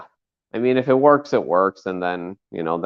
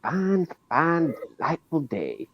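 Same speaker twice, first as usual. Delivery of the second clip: Tiny Tug; 4 semitones higher than usual. Acted. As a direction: play a folksy Southern character addressing the fans. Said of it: "Here is Tiny Tug".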